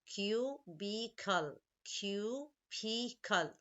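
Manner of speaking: Cubicle said slowly, syllable by syllable; split into syllables. Shown as a demonstration. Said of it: "Three syllables".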